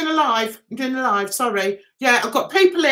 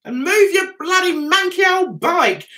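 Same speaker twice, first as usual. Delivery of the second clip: raised, higher scolding yell; mock shout; 6 semitones higher than usual. Scolding the neighbour about his old bike, raised yell.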